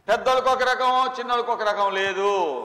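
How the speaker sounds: loud, slow declaiming from the pulpit; forceful sermon voice; preaching. Acted. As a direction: drive home each phrase like a preacher.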